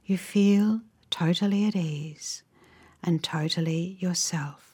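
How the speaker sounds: unhurried, gentle phrases, leaving space to imagine; guided relaxation. Real, not acted. Guide leading listeners into a calming visualization, slow guided meditation.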